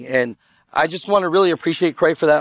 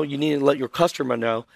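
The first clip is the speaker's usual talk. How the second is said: usual speaking voice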